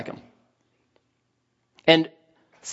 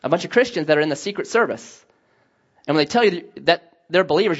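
ordinary talk, nothing marked

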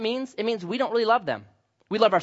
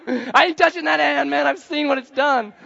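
high, loud, laughing "no way"; laughing mock refusal; 9 semitones higher, 7 dB louder than usual. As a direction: refuse the hand while cracking up. Acting refusing handshake.

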